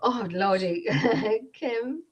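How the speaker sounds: ordinary talk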